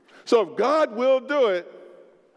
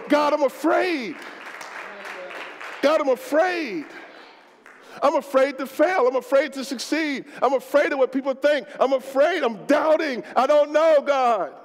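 fearful prayer; 5 semitones higher, 3 dB louder than usual. Pretend. Preacher voicing a scared believer, pleading loud. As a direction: confess your fear out loud to God.

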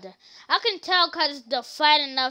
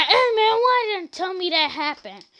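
whiny retold complaint; 5 semitones higher, 4 dB louder than usual. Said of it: "Then he said".

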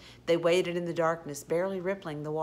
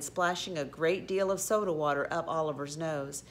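plain reading voice

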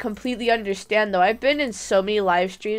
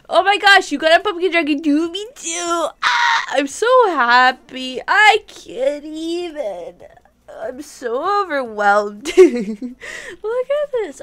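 overjoyed squeal; 5 semitones higher, 3 dB louder than usual. Real, not acted. Sees matching pumpkin dragon.

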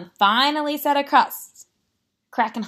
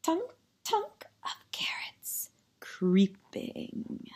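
hushed, drawn-out spooky storytelling; slow right down and fade into a whisper; soft, sinister; 5 dB quieter, slower than usual. A narrator's reading. Narrator describes carrots creeping up, soft and sinister. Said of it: "The soft, sinister".

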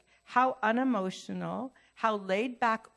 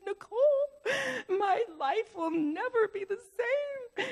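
mock tearful wail; 13 semitones higher than usual. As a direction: wail it like an exaggerated self-pitying retelling. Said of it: "I'm just crying".

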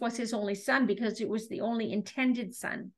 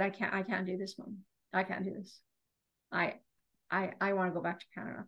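very soft, low, defeated voice; small halting plea, barely voiced; retold tearful; 5 semitones lower, 10 dB quieter, faster than usual. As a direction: imitate a daughter giving up in tears, hushed. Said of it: "she just started to cry".

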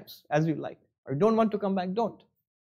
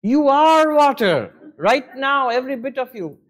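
scolding; 6 semitones higher, 6 dB louder than usual. Acted. Vedantic wave correcting the doubting little wave, scolding. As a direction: scold emphatically, stretching the first words. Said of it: "scold him and say".

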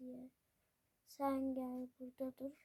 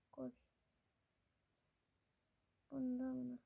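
usual speaking voice

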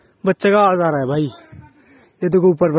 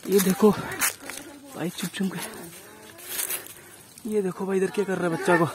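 quiet, tense whispers while watching; hushed frightened narration; hushed and scared; 3 semitones higher, 9 dB quieter than usual. Real, not acted. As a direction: keep your voice down, you're afraid of being heard. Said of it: "डर लग रहा है मेरे को".

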